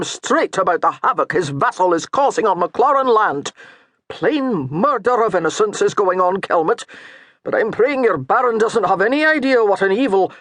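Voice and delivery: a hint of righteous anger; lightly voiced indignant priest. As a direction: press the outrage steadily. Priest denouncing the vassal.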